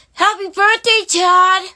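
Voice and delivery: very high, loud celebratory shout; excited birthday cheer. Acted.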